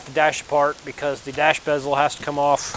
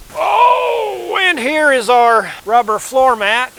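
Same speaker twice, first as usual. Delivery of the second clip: excited; 8 semitones higher, 6 dB louder, slower than usual. Acted. Unboxing floor mat.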